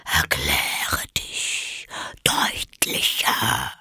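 low, slow whispered demand; stern caterpillar. A narrator's reading. The caterpillar sternly questions Alice in a whisper. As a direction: press her in a low whisper. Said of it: "fragte die Raupe streng".

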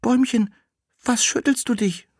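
put-on the girl asks the shaking tree in a high childlike voice; little girl. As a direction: ask like a small child. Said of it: "das ... herankam und sprach".

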